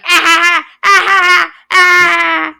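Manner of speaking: loud, high mock laugh; forced, high, loud laughter in bursts; imitated mocking laugh. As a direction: imitate a kid's jeering "ah-ah-ah". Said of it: "was like".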